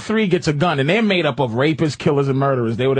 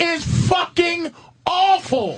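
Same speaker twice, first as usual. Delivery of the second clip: much higher, slow shout; 13 semitones higher, slower than usual. Acted. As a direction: bellow it out.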